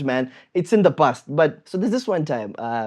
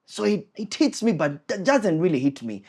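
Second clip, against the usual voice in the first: ordinary talk, nothing marked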